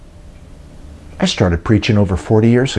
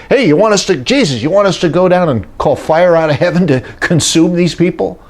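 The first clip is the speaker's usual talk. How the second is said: 1 eager disciples; 7 semitones higher than usual. Retold or imitated. Eager disciples ask Jesus for fire.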